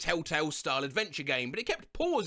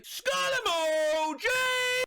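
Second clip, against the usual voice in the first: high drawn-out squeal; 3 dB louder, much slower than usual. Acted. Announcing phrase.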